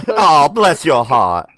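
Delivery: slow, lightly put-on Southern drawl; a hint of a Southern belle, drawn out; Southern accent. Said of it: "trying to do a Jensen impression of a Southern accent".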